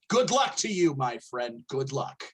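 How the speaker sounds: usual speaking voice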